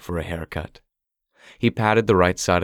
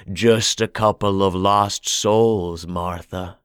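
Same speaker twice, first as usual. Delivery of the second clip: put-on hoarse old man; slower than usual. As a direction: drawl it like a creepy old storekeeper. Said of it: "in a hoarse voice".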